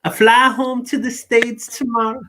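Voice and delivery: high, put-on American accent, breaking into a laugh. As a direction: give in and ham up the American lady. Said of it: "I'm not even gonna try and do her accent".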